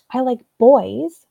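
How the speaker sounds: put-on BOYS drawn out and loud; emphatic retold answer. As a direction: imitate a child stressing "boys", slowly. Retold child spelling out her answer.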